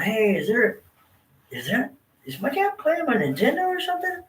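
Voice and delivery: high, curious kid voice, imitating himself; a boy wondering aloud at the red window; puzzled kid. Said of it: "I remember going".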